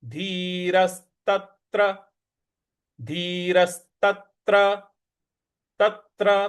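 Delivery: धीरस्तत्र slow with a light hit on त्र; stress on त्र. Shown as a demonstration. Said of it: "उस पर भी हमको थोड़ा सा आघात देना है".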